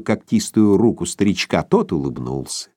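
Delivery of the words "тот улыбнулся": usual speaking voice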